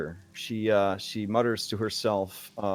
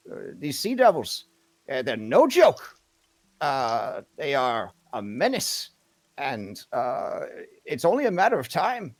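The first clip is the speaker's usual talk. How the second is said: higher, emphatic leader's voice; earnest, insistent townswoman; 5 semitones higher, 3 dB louder than usual. Acted. Townswoman urges adventurers to help their neighbors, higher insistent voice. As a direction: urge them on with swooping, punched key words.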